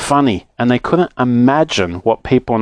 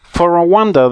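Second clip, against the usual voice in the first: ordinary delivery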